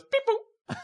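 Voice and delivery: very high beeping noise; electronic beep. Retold or imitated. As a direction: chirp it like a lock beeping. Security lock beeping.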